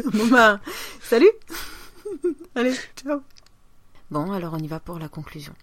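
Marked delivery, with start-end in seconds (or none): 0.0-3.2 cheery sign-off. Shown as a demonstration. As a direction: wave off the audience brightly. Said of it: "comment tu l'as conclu, ton émission?".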